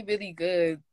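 ordinary talk, nothing marked

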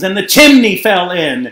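ordinary delivery

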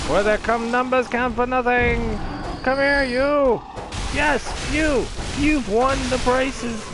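high, loud theatrical declaiming; theatrical old-fashioned voice. Acted. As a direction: ham it up like an old stage actor.

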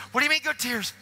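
acted alarmed disbelief. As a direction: play a daughter demanding an explanation. Daughter baffled and alarmed.

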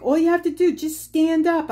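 loud, high, coaxing call; friend calling up. Retold or imitated. Friend Oriani calling out to her stuck on the zipline. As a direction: urge her up from across the course. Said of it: "And Oriani's like".

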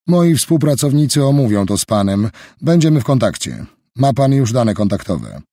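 smooth veiled pressure; Roberts voiced. A narrator's reading.

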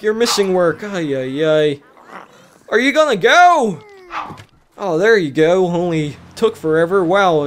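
normal talking voice

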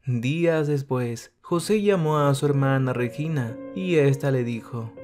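usual speaking voice

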